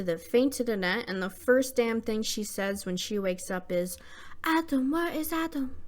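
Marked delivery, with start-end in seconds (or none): 4.4-5.8 much higher, put-on mock accent; whiny imitation of her odd accent; mocking Annette's accent. Annette wakes and asks for Adam, mocking her odd accent. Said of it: "What is that accent?".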